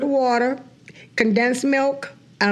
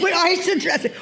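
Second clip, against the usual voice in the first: laughing; 7 semitones higher than usual. Real, not acted. Echoing with a laugh.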